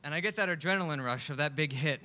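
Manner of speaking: ordinary talk, nothing marked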